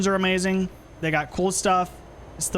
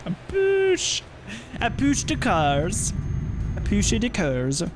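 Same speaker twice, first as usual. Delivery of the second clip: slow, hammed-up German accent; mock German, stretching the words out; 4 semitones higher, slower than usual. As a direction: play around with a drawn-out cartoon German accent. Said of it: "a stereotypical German accent".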